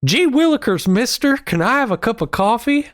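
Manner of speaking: put-on high, eager old-timey kid voice; wholesome 1950s kid. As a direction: chirp it like a golly-gee newsboy. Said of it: "And I said".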